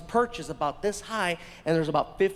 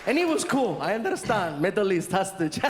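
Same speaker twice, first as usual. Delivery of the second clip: ordinary talk, nothing marked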